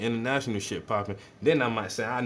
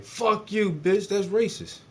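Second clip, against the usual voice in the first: high, loud mock indignation; mock offended outburst; 10 semitones higher, 4 dB louder, slower than usual. Answering someone who doubts his California claim, mock offended outburst. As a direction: snap back in fake outrage, pitched way up.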